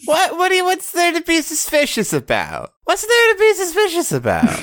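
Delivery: high, feigned innocence; mock innocent. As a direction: play wide-eyed innocent.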